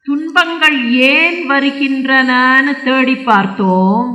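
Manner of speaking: slow, loud, held syllables; drawn-out declamation. Acted. Bull tells king of searching for sorrow's cause, drawn out. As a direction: stretch the line out in a ringing recitation. Slow first take, repeated normally after.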